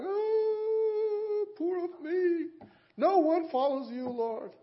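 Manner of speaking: high, loud mock whine; self-pitying Elijah. Elijah whines to the Lord that no one follows, mock wailing. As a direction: wail of poor-me self-pity.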